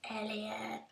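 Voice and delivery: breathy whisper of a name. Pretend. E.T. reference, whispered.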